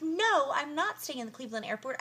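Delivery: high, emphatic mock refusal; acted-out indignant no; retold indignant protest. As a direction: push back in mock outrage. Retold argument with desk woman.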